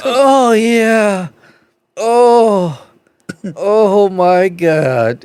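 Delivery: high, loud put-on groans; loud comic groaning, dragged out; mock moaning. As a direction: ham up a stranger's bathroom moans. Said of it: "He was like".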